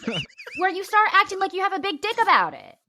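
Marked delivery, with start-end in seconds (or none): 1.4-2.8 high, loud comic tirade; hammed-up indignant outburst, pitched up; exasperated rant. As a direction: scold the host in mock outrage. Acted exasperated rant at absent host.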